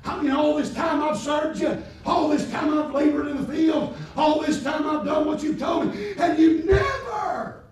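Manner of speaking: high, loud, indignant outburst; pitching a fit. Acted. Elder son pitching a fit at his father, shouted high. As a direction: throw a tantrum at your father. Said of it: "That was me trying to pitch a fit".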